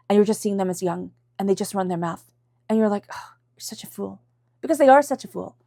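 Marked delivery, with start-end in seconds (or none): 3.1-4.2 exasperated whisper; hushed, voiceless aside. Retold or imitated. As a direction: whisper it like a private judgment. Acted private aside about someone.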